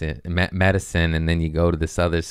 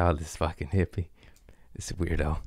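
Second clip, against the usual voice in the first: amused whisper under the breath; whispered aside; 3 semitones lower, 8 dB quieter than usual. Retold or imitated. Shaman laughing at the weird guest, whispering. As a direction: whisper it so he can't hear. Shaman mutters about him.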